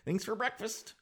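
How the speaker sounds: high, sweet, eager-to-please thanks; mock polite Canadian tourists thanking the waitress; overly polite. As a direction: gush politely like a nervous tourist. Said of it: "We're being all Canadian".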